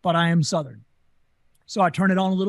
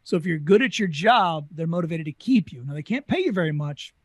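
ordinary talk

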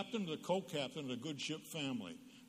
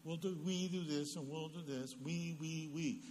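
lightly voiced little girl chatter, softer; a hint of a chatty little girl; little girl talk; 3 semitones higher, 4 dB quieter than usual. Preacher mimics little girls playing, lightly voiced girlish chatter. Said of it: "Little girls talk like this".

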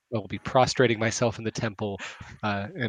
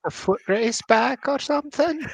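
teasing character voice; 11 semitones higher, 4 dB louder than usual. Acted. Cleric teases the returning pair.

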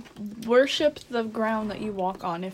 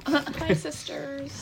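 James Charles voice; 4 semitones higher, 5 dB quieter, much slower than usual. Retold or imitated. Imitating James Charles greeting.

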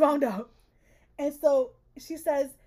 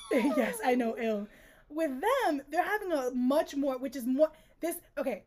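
ordinary talk, nothing marked

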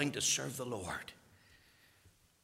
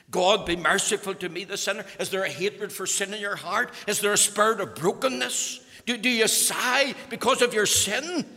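louder, higher urgent appeal; pleading preaching; 7 semitones higher, 7 dB louder than usual. Real, not acted. Preacher urging repentance, loud and rising. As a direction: press hard on the listeners' hearts.

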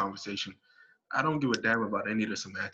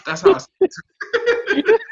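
much higher, slow, louder laughing words; 9 dB louder, much slower than usual. Real, not acted. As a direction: break into laughter on the last words. Ends rant in laughter.

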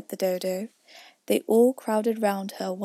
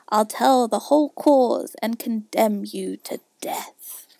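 slow, loud, ominous verdict; emphatic poem ending; verse recitation; 6 dB louder, slower than usual. A narrator's reading. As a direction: draw out the sentence like a doom. Recites the mouse's tale.

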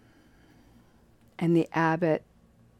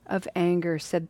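ordinary talk, nothing marked

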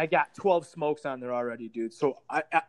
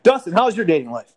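loud deflection; 3 semitones higher, 11 dB louder than usual. Real, not acted. Abrupt topic change to Dustin.

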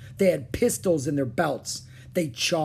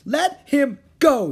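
high, loud, slow demand; fierce demand; 13 semitones higher, 9 dB louder, slower than usual. Acted. Annie charging the biggest pirate, fierce demand. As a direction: yell each word separately.

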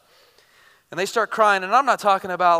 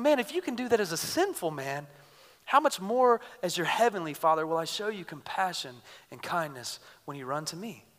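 soft voice of God; 3 semitones lower, 10 dB quieter than usual. Acted. Voicing God as compassionate Father.

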